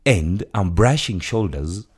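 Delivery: ordinary talk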